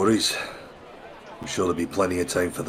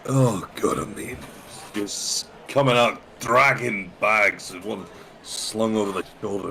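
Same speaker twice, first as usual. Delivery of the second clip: ordinary talk, nothing marked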